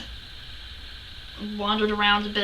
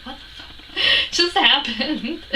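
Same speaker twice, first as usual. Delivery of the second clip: disbelieving question broken by laughter; slow, laughing bewilderment; baffled laughing; 4 semitones higher, slower than usual. Retold or imitated. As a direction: crack up halfway through the question. Retells bafflement, breaks into laughter.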